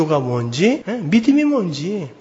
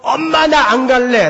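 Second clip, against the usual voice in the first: drawn-out, higher protest; imitated child's yell; 5 semitones higher than usual. Preacher imitates child refusing to go, yelling. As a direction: mimic a child yelling to mother. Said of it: "라고 외쳤던 그 부르짖음".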